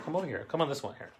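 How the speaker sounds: ordinary talk, nothing marked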